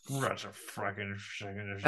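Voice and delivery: low, slow wheezing cartoon-dog mumble; Muttley snicker. Podcaster imitating Muttley from Wacky Races, slow and low. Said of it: "literally talks like Muttley".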